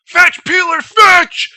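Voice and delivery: high, full-force imitated drill instructor shout; explosive high-pitched mock order; yelled command. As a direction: holler it across the barracks like ordering a dog. Acting instructor yelling fetch to recruit.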